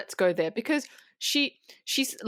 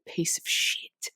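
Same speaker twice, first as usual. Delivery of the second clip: slow whispered emphasis; slower than usual. Real, not acted. Stressing the cruelty she describes.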